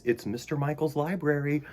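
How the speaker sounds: usual speaking voice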